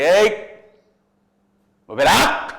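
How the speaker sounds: loud police shout; yelling cop. Retold or imitated. Constable yelling at the driver to stop the car. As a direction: bark it like a traffic cop stopping a car. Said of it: "એવી રાડ પાડે".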